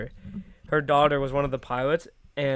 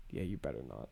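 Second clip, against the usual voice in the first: very low, quiet mock threat; imitated low, menacing murmur; stern teacher warning; 13 dB quieter than usual. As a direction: drop right down for a teacher's warning.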